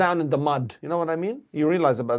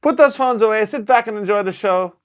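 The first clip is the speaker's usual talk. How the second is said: higher, louder, fast stage host voice; cheesy opening-act announcer, higher and louder; retold emcee announcement; 6 semitones higher, 6 dB louder than usual. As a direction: pump up the crowd like a show MC. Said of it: "And I go".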